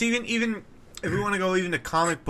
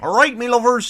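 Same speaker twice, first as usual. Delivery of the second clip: higher-pitched hammed-up Bristolian greeting; put-on West Country accent; mock Bristol accent; 9 semitones higher, 6 dB louder than usual. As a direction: greet them like a bad Bristol impression. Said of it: "He's from Bristol".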